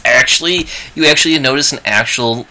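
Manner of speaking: ordinary talk, nothing marked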